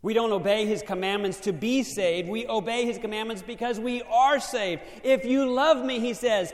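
loud, high-pitched preaching. Acted. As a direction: preach it out with fire. Preaching at full force.

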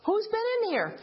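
suddenly alarmed that someone moved her book; startled alarm. Retold or imitated. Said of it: "And I'm going".

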